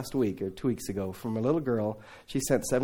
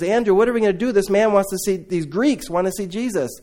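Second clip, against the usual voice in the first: flustered Philip; 6 semitones higher, 5 dB louder than usual. Retold or imitated. Philip panicking to Andrew.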